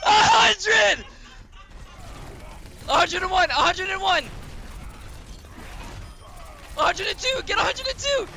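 high, rising count-up cheer; excited counting. Real, not acted.

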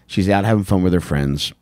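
ordinary talk, nothing marked

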